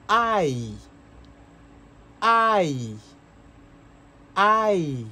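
I drawn out as "ai"; close English I. Shown as a demonstration. Teacher repeats I up close for learners. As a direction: say I slowly for the class. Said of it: "Vamos a pronunciar un poquito más de cerca".

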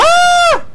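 very high, loud shriek; startled scream. Real, not acted. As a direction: scream at a sudden scare. Startled by creature in game.

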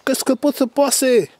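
high, drawn-out outraged impression; mock yelling. Mimicking the owner finding his gear touched, mock yelling. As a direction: wail it high like the owner losing it, hammed-up. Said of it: "il va faire, wesh".

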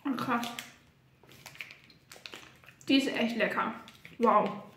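talking while chewing; mouth full. Real, not acted. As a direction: speak through a mouthful of chocolate. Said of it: "mit vollem Mund gesprochen".

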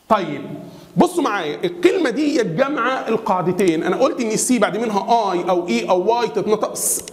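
ordinary talk, nothing marked

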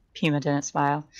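ordinary delivery

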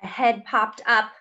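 usual speaking voice

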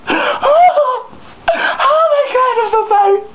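high falsetto mock wail; hammed-up sobbing apology in a squeaky woman's voice; crying woman. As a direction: imitate a distraught woman, very high-pitched. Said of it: "she's still over me crying".

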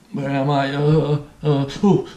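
mock disoriented groping in the dark. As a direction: act out stumbling about lost, with little uhs and an ooh. Acting out being lost in dark.

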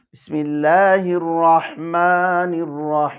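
রহিম with its long i held three beats; three-alif stop. Shown as a demonstration. Teacher demonstrating tul stop, longest. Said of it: "তিন আলিফ".

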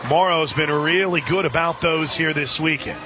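ordinary delivery

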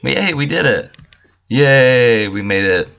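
high, loud celebratory yay; real whoop of triumph; excited cheer. Real, not acted. Cheering after landing a jump.

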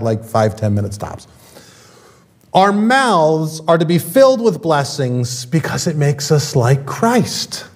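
ordinary talk

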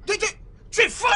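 furious shout. Acted. Master yelling at the clumsy maid, furious shout. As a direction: shout it high and loud, furious.

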